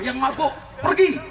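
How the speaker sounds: retold angry order to leave; imitating angry organizers. Committee ordering drunk youths away from the stage, mock angry. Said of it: "panitia marah-marah".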